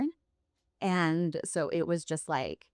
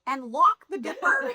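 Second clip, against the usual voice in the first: high, fast words broken by real laughter; laughing. Real, not acted. To the host, laughing as her husband locks her out. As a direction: laugh your way through the memory.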